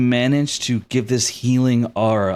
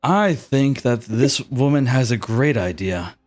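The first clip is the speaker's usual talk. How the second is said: restrained, lightly voiced prince; a hint of soft royal composure. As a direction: speak with measured, quiet authority. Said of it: "you hear a soft voice".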